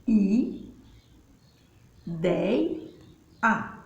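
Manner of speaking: "I, dei, a" split with long pauses; syllable by syllable. Shown as a demonstration. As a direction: sound out each syllable separately. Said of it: "pode ver que eu pronuncio". (sílaba por sílaba, com pausas longas)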